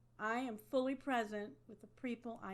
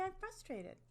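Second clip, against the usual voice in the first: frustrated statement; 9 semitones higher than usual. Shown as a demonstration. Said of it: "I say".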